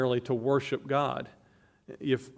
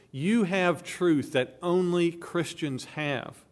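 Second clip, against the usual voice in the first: ordinary delivery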